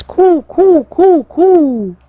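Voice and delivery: high, loud hooting coo; owl call. Retold or imitated. Father swan imitating the little owl, high hoots. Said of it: "the little owl that says".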